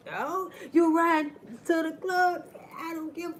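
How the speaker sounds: high, slow sassy brush-off; dismissive sass. Retold or imitated. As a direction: wave the complaint away. Dismissing the cracked mirror.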